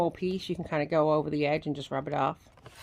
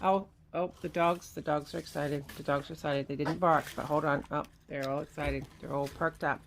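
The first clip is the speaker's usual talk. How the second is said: hushed; 4 semitones lower, 6 dB quieter than usual. Real, not acted. Noise maker woke the dogs.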